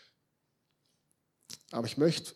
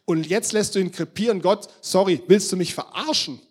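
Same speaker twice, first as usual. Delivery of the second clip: loud, raised-pitch outcry at God; aufgebrachter Protest; 6 semitones higher, 11 dB louder than usual. Acted. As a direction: hurl the accusation upward, louder and higher. Acted protest of Elia to God. (aufgebrachter, lauter Protest)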